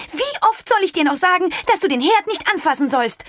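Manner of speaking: mother scolding her daughter at the stove. A narrator's reading. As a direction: tell the child off sharply. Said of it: "schimpfte sie".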